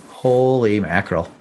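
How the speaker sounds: normal talking voice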